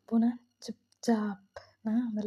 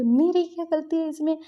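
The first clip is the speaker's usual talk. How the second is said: higher, louder retold protest; hurt protest; 5 semitones higher, 4 dB louder than usual. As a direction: demand what her fault is. Girl protests.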